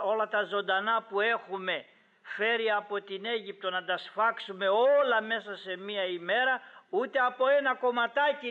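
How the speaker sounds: imitating a flustered man's protest; incredulous protest. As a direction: argue it can't possibly be done. Moses protests to God in disbelief.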